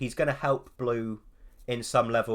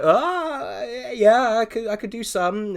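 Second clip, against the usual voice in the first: higher, louder bashful reply; sheepish younger self; 10 semitones higher, 7 dB louder, slower than usual. Retold or imitated. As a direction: play your shy schoolboy self, pitched up. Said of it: "And I'm like".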